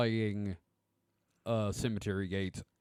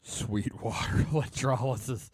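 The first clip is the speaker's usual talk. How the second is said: laughing; 2 semitones higher than usual. Real, not acted. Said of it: "Oh, that's funny".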